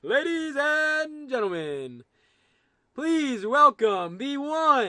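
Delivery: booming, slow announcer intro; fighting game announcer. Pretend. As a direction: stretch every word like a ring announcer. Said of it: "get my announcer voice on".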